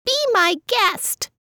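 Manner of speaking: very high squeak; squeaky mouse voice. Acted. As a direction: squeak it back cheekily. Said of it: "the mouse squeaked back".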